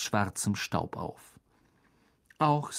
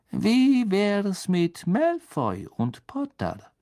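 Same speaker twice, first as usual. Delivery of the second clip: slow, higher, insinuating suggestion; sly crooked smile; 8 semitones higher, slower than usual. A narrator's reading. Snape proposing two rivals, sly and drawn out. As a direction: draw it out through a crooked smile. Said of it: "sagte Snape mit einem schiefen Lächeln".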